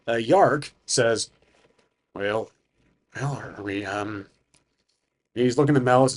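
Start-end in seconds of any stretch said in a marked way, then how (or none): 2.1-4.3 put-on low, soft, hesitant old man; lowered voice, feeling for the words; old, frail, low. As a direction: falter quietly as a frail elder. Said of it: "he talks low".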